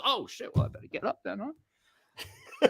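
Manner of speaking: soft, realizing, ending in a laugh; dawning fighter. Retold or imitated. Fighter realizing he must get up, soft amused. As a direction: fighter suddenly remembers he's in a fight. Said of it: "And he goes".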